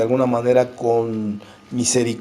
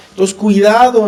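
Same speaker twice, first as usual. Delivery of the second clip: louder, higher sudden warning; 6 semitones higher, 7 dB louder than usual. Real, not acted. Preacher warning his brothers, loud and higher. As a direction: call out the warning to the brethren.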